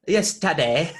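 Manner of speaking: put-on playful British baby voice; British accent. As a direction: echo it in mock British. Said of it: "she got a more British accent".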